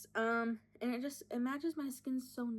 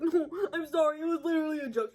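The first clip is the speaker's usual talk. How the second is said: higher, louder mock apology; lightly put-on defensive guy voice; imitated apologetic guy; 6 semitones higher, 6 dB louder than usual. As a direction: mimic a guy backpedaling on his joke. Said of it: "And he's like".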